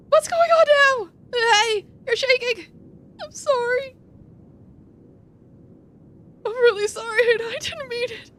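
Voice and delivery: high, trembling voice near tears; a scared young man apologising as he panics; frightened Marn. Acted. To Wiki, frightened Marn pleads, high and near tears.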